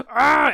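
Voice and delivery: high, loud, hammed-up yell of rage; mock frustrated yell. Elvis snubbed by Susan, high and loud mock yell. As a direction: let out a cartoonish angry shout. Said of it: "Elvis just goes like".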